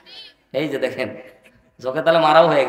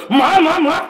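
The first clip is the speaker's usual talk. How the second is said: উঁচু গলায় নকল ডাক; high-pitched mock cry, repeated in quick bursts; নকল চিৎকার; 11 semitones higher, slower than usual. যুবকের আচমকা চিৎকারের নকল. (যুবকের উঁচু গলার ডাকের নকল)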